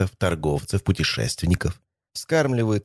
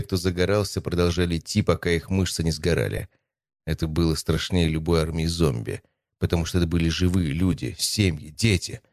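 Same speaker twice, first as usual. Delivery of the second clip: usual speaking voice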